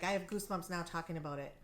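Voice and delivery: ordinary talk, nothing marked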